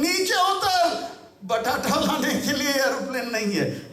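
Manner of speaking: hammed-up scolding, pitched high; mock scolding order. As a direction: shout them down with playful outrage. Repeats the acted scolding.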